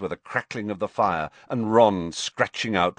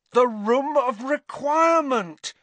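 sudden realisation; 4 dB louder than usual. Acted.

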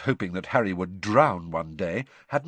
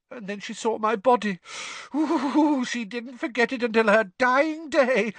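put-on high, whiny ghost-girl voice; shrill wounded storyteller, often loud; 13 semitones higher, 4 dB louder than usual. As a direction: tell it in a high, self-pitying wail. Myrtle tells of her death.

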